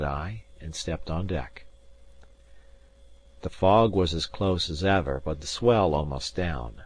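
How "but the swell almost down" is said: ordinary delivery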